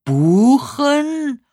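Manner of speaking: high, loud, incredulous echo; hard-of-hearing old man querying a misheard word; baffled old man. A narrator's reading. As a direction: swoop up loudly in disbelief. Deaf old man mishears again.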